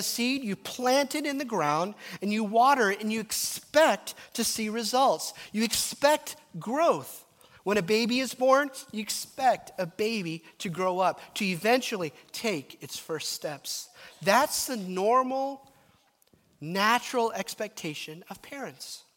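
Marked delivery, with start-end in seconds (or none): none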